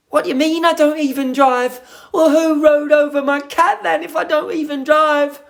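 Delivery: high, loud indignant put-on voice; mock indignation. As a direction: protest like an outraged cartoon character. Acting indignant viewer character.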